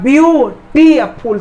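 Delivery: View, टी, फुल as three separate beats; split into syllables. Shown as a demonstration. Teacher asking how many parts it has, split into syllables. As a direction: count out view, टी, फुल with gaps. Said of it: "उच्चारण करने में कितना हिस्सा बंट रहा है".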